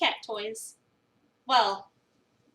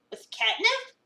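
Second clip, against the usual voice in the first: incredulous; 11 semitones higher, 3 dB louder, slower than usual. Acted. Avery incredulous at the prank.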